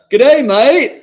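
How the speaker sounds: high, loud Aussie "Good day, mate"; Australian accent. Pretend. Answering the Melbourne viewer in a mock Australian accent. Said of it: "That's my Australian president".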